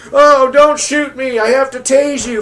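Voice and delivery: high-pitched mock-frightened whine; mocking scared cop. Mocking scared cops' excuse.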